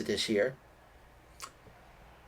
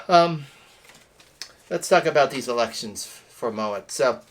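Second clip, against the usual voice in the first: ordinary talk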